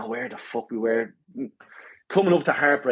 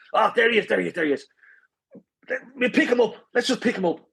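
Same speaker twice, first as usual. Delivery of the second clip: higher-pitched, eager retold glee; excited spotting; 8 semitones higher, faster than usual. Runner to his mate, spotting Ray on the hill, excitedly. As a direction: relive the thrill of spotting your target.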